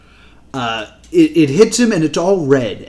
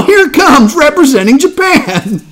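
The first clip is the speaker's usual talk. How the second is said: announcer voice; 9 semitones higher, 4 dB louder than usual. Pretend. Announcing next mock act.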